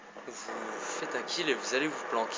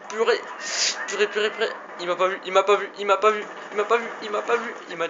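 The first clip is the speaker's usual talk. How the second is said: excited shouting; 8 semitones higher, 10 dB louder, faster than usual. Real, not acted. Sudden enemy encounter in game.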